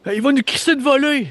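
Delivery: high-pitched, urgent squawk of alarm; panicked character voice. Acted. Stacy begs them to neutralize the rising corpse, high panicked voice. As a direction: shriek it in a high, hammed-up panic. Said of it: "Puis Stacy a dit".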